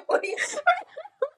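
shrieking laugh; high burst of laughter. Real, not acted.

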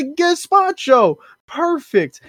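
high, loud, stretched-out cheer; overjoyed greeting. Retold or imitated. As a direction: hail a rescuer with hammed-up delight. Heroes greet rescuer in story.

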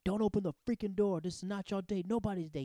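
imitated yelling. Buff cop yelling at people on the train, imitated. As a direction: bark it at passengers like an irritated cop. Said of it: "he's sitting there yelling at people like".

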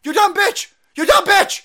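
loud, high real outburst; furious shouting. Real, not acted. Host cuts off the politician's clip, furious shouting.

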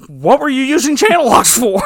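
loud, high outraged husband; hammed-up shouted demand to a wife; exasperated mock shout. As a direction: yell it like a baffled husband. Acted toolbox quarrel with wife.

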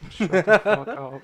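slow, laughing insult; playful shut up through laughter. Real, not acted. Friend's joke cracks him up.

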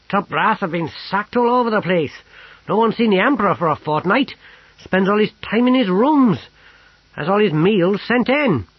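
loud, high-pitched soldier's voice; gruff old army cook. A narrator's reading. Army cook briefing his sergeant friend, loud and blunt. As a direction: report it forcefully, old-soldier style.

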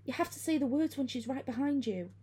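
high, urgent instruction; hurried urging. A narrator's reading. Friend instructs terrified girl, hurried and urgent. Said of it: "Steffi said hurriedly".

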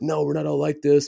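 mild imitation of youthful objecting; mock protest. Acted protest against a stereotype.

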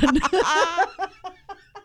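high, loud fit of laughter; loud burst of laughter. Real, not acted. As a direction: crack up helplessly. Cracking up after flub.

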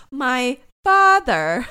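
loud, drawn-out mock indignation; mock outrage. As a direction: stretch it slowly and loudly in mock offense. Mocking offended-father line, drawn out.

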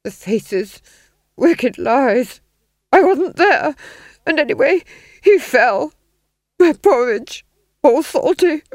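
high, loud, halting babble; deranged muttering. A narrator's reading. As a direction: rave in disjointed fragments. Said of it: "murmured the Duke, swaying".

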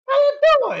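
very high, loud put-on voice; hammed-up high-pitched greeting; cartoon tough cat. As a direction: squeal it out in cartoon character. Acting a noise from shadows.

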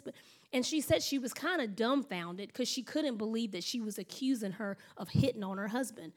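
ordinary delivery

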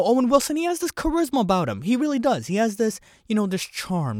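a botched go at Owen Wilson's voice; Owen Wilson impression. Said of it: "I can't even do an Owen Wilson impression".

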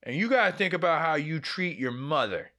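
a hint of a ranting mom; mother's rant. Mother scolding her son about his treatment of her, mock rant. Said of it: "Then she goes on this rant".

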